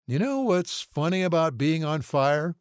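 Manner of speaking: drugged burn patient. A narrator's reading. Drugged patient chatting up the nurse, dazed.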